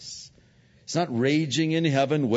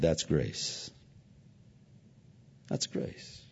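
second one almost whispered; soft repeated words; 7 semitones lower, 6 dB quieter, slower than usual. Retold or imitated. Father handing son cookies, whispering that's grace. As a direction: let the word grace sink in softly.

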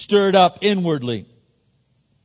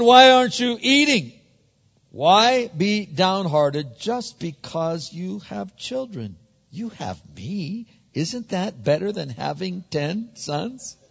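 higher-pitched, drawn-out coaxing; a hint of a clueless husband consoling his wife; lightly voiced husband; 5 semitones higher than usual.

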